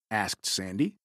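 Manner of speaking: plain reading voice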